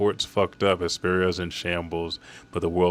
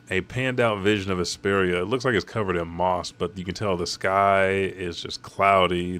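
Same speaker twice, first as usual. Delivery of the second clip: ordinary talk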